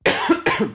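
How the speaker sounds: sudden coughing fit; coughing on a drink. Real, not acted. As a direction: cough after swallowing wrong. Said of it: "j'ai bu de travers".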